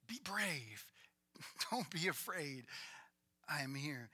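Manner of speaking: Jesus softly reassuring the scared disciples; gentle reassurance. Acted. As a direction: soothe them softly, calm and close. Jesus comforting frightened disciples.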